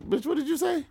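turning on her in mock outrage; outraged squeal. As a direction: shriek it back in disbelief.

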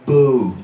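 loud, blunt boo; mock music description. Shown as a demonstration. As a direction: boo it out like a jeering crowd. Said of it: "it would be like this".

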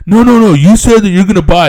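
hammed-up high yell of a pushy dealer; insistent salesman. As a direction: play the dealer losing it.

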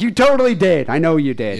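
high, loud mock outburst; retold accusation. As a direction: fire back an exasperated accusation, loudly. Son accusing dad in retold quarrel.

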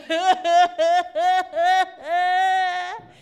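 hearty retold laugh, loud; big belly laugh; pastor's laugh. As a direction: laugh it off warmly. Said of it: "he's just laughing at me".

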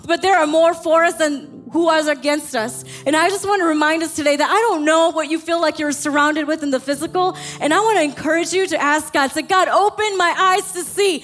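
high-pitched, driving sermon delivery; rally the congregation with unrelenting urgency; impassioned preaching. Real, not acted. Pastor rouses the church to fight back, fervent preaching. Preaches at rising full force.

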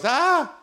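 a short sharp high yelp; sudden cry out. Shown as a demonstration. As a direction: let out one quick startled cry. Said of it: "cried out and goes".

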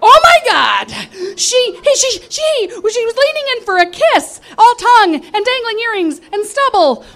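high, loud shocked cry; shocked shout. Real, not acted. Narrator recoiling from a drag queen's kiss, shouting in shock. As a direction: yelp it out in horror.

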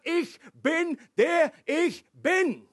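"Ich bin, der ich bin" high, loud and slow; emphatic proclamation. Shown as a demonstration. Preacher quotes God's name as the provocation, emphatic. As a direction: hammer each word of "Ich bin, der ich bin" separately. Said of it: "Das war das Reizwort".

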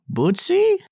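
put-on high, slow, hopeful old voice; frail old woman. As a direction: call out like a frail grandma. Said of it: "a frail voice asks".

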